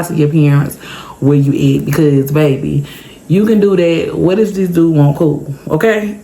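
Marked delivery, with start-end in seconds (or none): none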